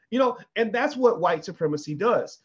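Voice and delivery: ordinary talk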